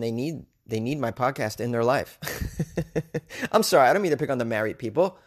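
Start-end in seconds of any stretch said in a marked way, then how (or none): 2.2-3.5 light, breathy run of laughter; real chuckle. Real, not acted. Podcaster teasing married listeners, real chuckling. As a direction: crack up softly at your own joke.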